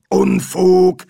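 loud, high, curt rebuff; sharp dismissal. Acted. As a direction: dismiss it loudly as nonsense. Medium dismisses the spirit.

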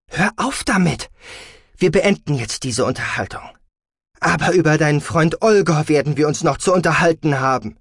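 exasperated parental rebuke; stern scolding. A narrator's reading. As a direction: order an end, then warn heatedly. Mother ends argument, threatens talk.

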